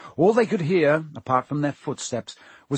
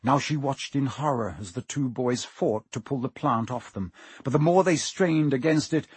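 ordinary delivery, nothing marked

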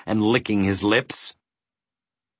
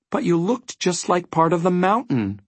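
meek, higher-pitched voice; 8 semitones higher than usual. A narrator's reading. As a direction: explain it timidly, pitched up. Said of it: "said Milo meekly".

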